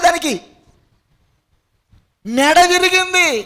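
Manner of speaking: loud, high-pitched sermon delivery; full-force preaching. Real, not acted. Preacher tells congregation of the devil's fall, full-force preaching. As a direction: belt it out to the congregation.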